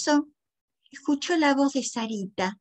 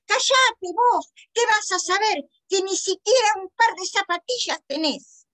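high, loud scornful yelling; angry shouting; 8 semitones higher, 4 dB louder than usual. A narrator's reading. Lucrecia insulting the boy over his sneakers, high angry shouting. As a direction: lash out in a raised, high voice. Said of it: "Lucrecia seguía gritando".

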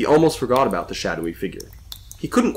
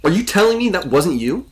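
higher-pitched exasperated question; frustrated; 8 semitones higher, 4 dB louder than usual. A narrator's reading. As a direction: demand an answer, mildly frustrated. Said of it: "Connor said in frustration".

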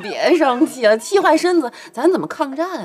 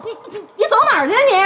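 flustered laughing plea; 9 semitones higher, much faster than usual. Acted. Daughter chases mother, laughing fast.

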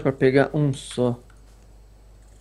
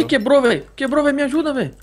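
high, frantic cry for help; panicked; 9 semitones higher than usual. Real, not acted. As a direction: panic as the weapon snaps. Spear breaks mid-fight.

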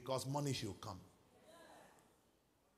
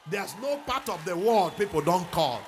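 loud, much higher preaching shout; 7 semitones higher, 16 dB louder than usual. Real, not acted. Preacher boasting to congregation, loud rising shout. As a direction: thunder it out to the crowd. Preacher declaring his worldwide reach.

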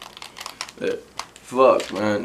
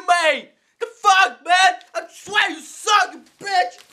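much higher, furious yelling; raging gamer; 10 semitones higher than usual. Acted. Angry gamer screaming at the stoned teammate who ruined the party.

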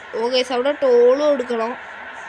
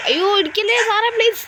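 high, loud begging yelp; real panic as the crash comes; panicked plea; 10 semitones higher, 4 dB louder than usual. Real, not acted. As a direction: plead not to be hit. Bus about to crash, begging.

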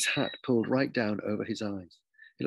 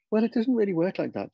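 high, louder teacherly correction; teacher gently objecting; 10 semitones higher, 5 dB louder than usual. Acted. Teacher telling the new boy how school works, high-pitched correction. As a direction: explain from on high, pitch lifted.

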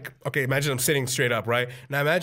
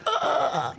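high, helpless groan; mock pained groan; 10 semitones higher than usual. Crashed boy answering his dad, feeble high groan.

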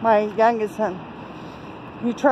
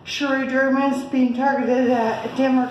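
usual speaking voice

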